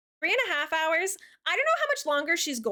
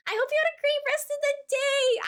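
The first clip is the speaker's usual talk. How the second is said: bright high thanks; 12 semitones higher than usual. Real, not acted. Cheery thank-you to raider.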